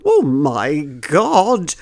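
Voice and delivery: slow, drawn-out 'oh my God'; mock swooning shock. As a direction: stretch it out like an overcome listener. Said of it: "they'll be kind of going".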